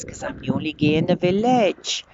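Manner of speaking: bad Welsh accent on purpose. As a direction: put on a hammy Welsh lilt. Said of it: "Yes, my Welsh accent is terrible".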